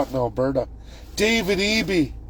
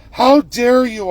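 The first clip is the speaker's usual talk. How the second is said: higher, louder outraged retort; indignant mock rebuke; 9 semitones higher, 6 dB louder than usual. Official scolding a citizen, mock outrage. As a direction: spit it out like an offended official. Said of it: "I was told".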